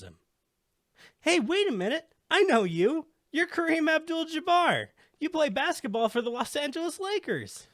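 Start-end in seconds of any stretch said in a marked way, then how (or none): 1.2-7.7 put-on high, eager boy's voice; the kid who just spotted his hero; excited kid Joey. Joey the boy recognizes the basketball star, high and excited. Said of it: "Joey has been paying very close attention".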